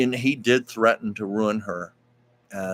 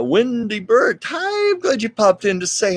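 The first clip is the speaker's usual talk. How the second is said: greeting; 12 semitones higher, 6 dB louder than usual. Real, not acted. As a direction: sing out a viewer's name with delight. Greeting a viewer.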